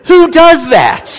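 high, loud, slow who does that; exasperated disbelief, stretched out; high loud mock disbelief; incredulous. Real, not acted. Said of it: "incredulous punchline".

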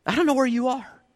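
ordinary delivery

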